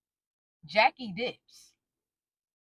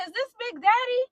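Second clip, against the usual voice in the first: much higher, questioning caller voice; friend on the phone; 11 semitones higher than usual. Retold or imitated. Friend checking she reached the husband, high questioning voice. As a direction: lift it high, checking who answered.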